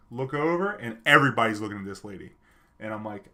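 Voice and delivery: usual speaking voice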